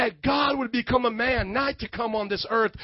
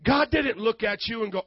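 ordinary talk, nothing marked

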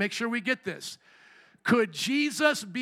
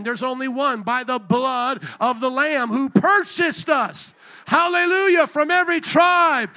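loud preaching, rising to a high climax; fervent preaching; 4 semitones higher, 8 dB louder than usual. Real, not acted. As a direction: drive it home to the congregation, building to a shout. Preaches at full force.

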